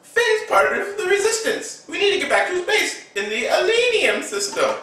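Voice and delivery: very high, fast explaining voice; high quick kid voice; high voiced Rey. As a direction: rattle it off in a high squeak.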